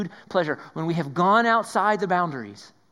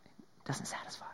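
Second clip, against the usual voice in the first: whisper; 16 dB quieter than usual. Acted. Pleasure reached, emptiness revealed.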